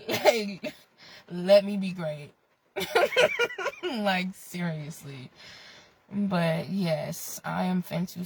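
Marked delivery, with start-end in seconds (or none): 2.7-3.8 sharp high-pitched giggle. Real, not acted. Laughing at own bit.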